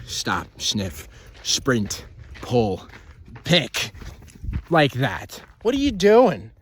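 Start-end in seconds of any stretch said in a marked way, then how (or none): 5.6-6.5 loud exasperated call to the dog. Real, not acted. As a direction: snap at the pulling dog. Scolding the dog.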